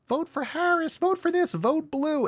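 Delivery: very high, mocking celebrity plea. Streamer parodying celebrities urging votes, high mock. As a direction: squeak it like a preachy star. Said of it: "every single celebrity".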